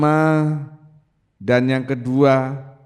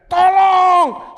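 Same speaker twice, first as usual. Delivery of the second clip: high, loud, sustained yell; shouted cry for help; 8 dB louder than usual. Acted. Someone in church suddenly calls for help, shouting. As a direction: scream so every head turns. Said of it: "ada yang teriak".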